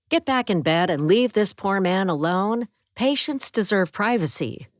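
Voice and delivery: a hint of a nurse putting a patient in his place; stern nurse. Nurse scolds menacing roommate.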